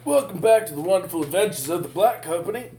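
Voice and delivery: upbeat announcer intro. Acted. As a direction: greet the audience grandly. Host intro after break.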